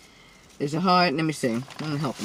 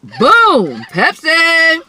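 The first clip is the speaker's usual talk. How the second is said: excited; 7 semitones higher, 9 dB louder, much slower than usual. Real, not acted. Mukbang opening with a drink.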